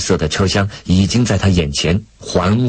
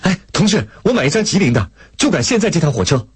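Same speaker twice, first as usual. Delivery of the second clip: high, hurried request; anxious, urgent; 10 semitones higher, faster than usual. A narrator's reading. Lei Feng buying a ticket at the window, anxious urgency. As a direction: rush the words out urgently. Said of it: "着急地把手伸向售票窗口".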